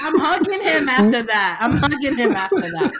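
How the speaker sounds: cracking up while answering; laughing. Real, not acted.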